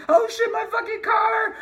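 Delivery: high, loud panic; imagined crash panic, yelled high; panicked shouting. Pretend. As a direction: shriek in horror at the crash. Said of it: "And then secondly".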